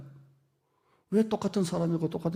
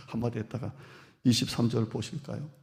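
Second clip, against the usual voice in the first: ordinary talk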